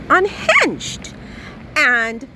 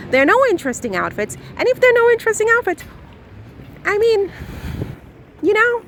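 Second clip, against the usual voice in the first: fast, higher excited rant; speaking very fast; 9 semitones higher, faster than usual. Real, not acted. Ranting to viewers about outfits without Megan, fast and high. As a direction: rattle it off as it races away from you. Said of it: "I'm speaking very fast".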